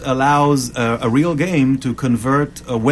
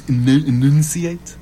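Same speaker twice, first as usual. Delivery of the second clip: slow, effortful stumble toward the word; slow down and feel for each syllable; trying to enunciate; slower than usual. Real, not acted. Struggling to speak before co-hosts, laboured enunciation. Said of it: "I'm trying to announce".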